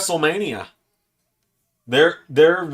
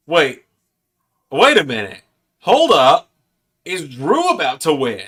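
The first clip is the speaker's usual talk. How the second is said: loud, rising disbelief; shocked; 2 semitones higher, 6 dB louder than usual. Real, not acted. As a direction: react as the finish unfolds. Reacting live to a match finish.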